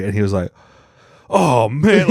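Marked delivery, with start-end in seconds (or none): 1.3-2.1 mock-dismayed groaning exclamation, higher; retold dismay. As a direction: act out his crestfallen reaction. Retells the comic's dismayed reaction.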